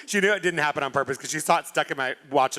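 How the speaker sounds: normal talking voice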